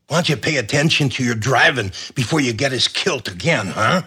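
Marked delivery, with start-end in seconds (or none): none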